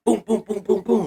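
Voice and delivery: high, rhythmic "boom" sound effects; cartoon fight noises; imitated punches. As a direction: punch out each boom like a blow. Imitates Samson beating them down.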